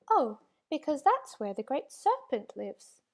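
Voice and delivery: usual speaking voice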